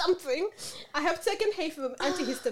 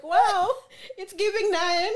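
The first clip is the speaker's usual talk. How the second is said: loud gushing hype; excited; 7 semitones higher, 5 dB louder, slower than usual. Retold or imitated. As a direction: gush it out, laughing. Mock excited voice of self.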